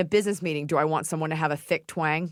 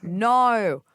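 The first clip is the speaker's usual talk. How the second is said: louder, drawn-out Australian no; 4 semitones higher, 5 dB louder than usual. Shown as a demonstration. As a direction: bend the vowel of "no" into Australian. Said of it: "saying no as an Australian".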